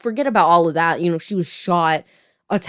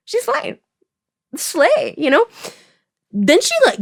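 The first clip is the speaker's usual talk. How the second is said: excited; 12 semitones higher, 5 dB louder than usual. Real, not acted. Hyped cheer for heroine.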